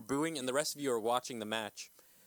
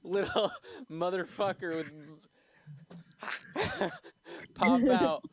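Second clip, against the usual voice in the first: laughing; 6 semitones higher, 4 dB louder than usual. Real, not acted. GM describing leprechaun laughing.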